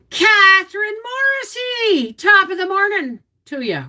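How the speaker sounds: high, hammed-up Irish lilt; Irish greeting. Cheery Irish hello to a viewer from Ireland. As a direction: greet her like a stage Irishman.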